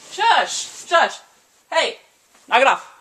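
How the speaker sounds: loud sharp shush, then a shout; real yelling. Real, not acted. Vlogger yells at whoever is making noise nearby, real loud scolding. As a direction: yell at the racket offscreen to stop.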